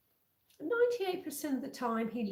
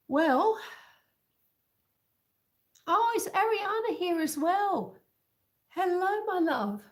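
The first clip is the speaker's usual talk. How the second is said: delighted greeting; 7 semitones higher, 5 dB louder than usual. Real, not acted. Greeting a viewer who arrived.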